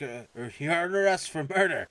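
mock cop voice. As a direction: announce the arrest like a movie cop. Officer arrests him.

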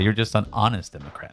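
ordinary talk, nothing marked

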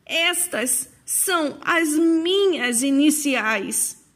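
put-on moved old woman, drawing out each word; deeply moved. A narrator's reading. Woman recognising her own embroidered initials, deeply moved. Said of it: "muito emocionada".

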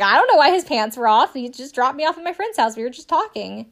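hammed-up flustered denial; mock defensive denial. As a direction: act out the woman's indignant excuse. Mocking the woman's flustered denial.

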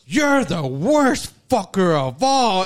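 slow, drawn-out acted denunciation; mock accusation. Movie guy singles out one man to blame, mock accusation. Acting out movie character's final rant.

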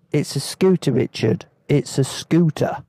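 cockney voice; quoting a mate in a London accent; East London accent. Retold or imitated. Lee's voice message quoted.

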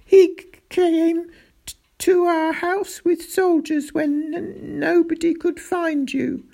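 high, stammering, tearful account; distraught, high-pitched grief; sobbed. A narrator's reading. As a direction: sob out the story, tripping on words. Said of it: "sobbed Roderick".

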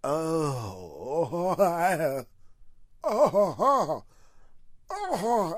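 mock dry heaving. Mimicking gagging at the dirty truck, rising retches. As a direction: retch at the sight in choked bursts. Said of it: "I was dry heaving".

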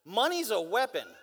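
usual speaking voice

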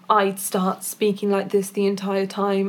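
monotonous, over-rehearsed reading; monotone. Shown as a demonstration. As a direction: keep every word on the same note. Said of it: "we often speak quite monotonous".

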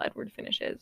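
ordinary talk

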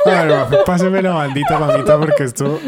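loud, laughing call to order. Real, not acted. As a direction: laugh through the words. Laughs while calling order.

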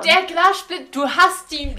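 loud, high protest; shouting. Real, not acted. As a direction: shout it over the others, insisting. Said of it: "Schrei nicht so".

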